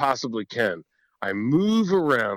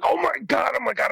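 startled; faster than usual. Retold or imitated. Deer startles him.

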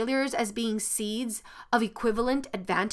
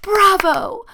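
cheering bravo; 9 semitones higher, 8 dB louder than usual. Acted. The world cheers the winner.

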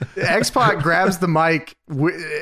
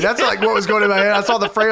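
ordinary delivery

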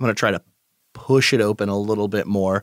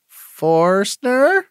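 raised, high searching call; 12 semitones higher, 4 dB louder, much slower than usual. Acted. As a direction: call into the house for someone. Calls into a seemingly empty house.

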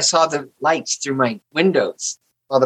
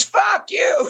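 retold high-pitched mock yell; imitated stagey shriek of abuse; loud theater voice. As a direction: play the staged fight to the back row. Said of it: "we were really loud, using our theater voices".

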